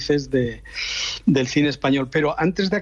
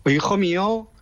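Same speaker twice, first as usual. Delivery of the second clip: higher-pitched Aragonese mother, sing-song; lilting mock-Aragonese aside from a mother to her son; Aragonese accent; 4 semitones higher than usual. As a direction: imitate Mum confiding in an Aragonese accent. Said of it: "con acento aragonés, me dice".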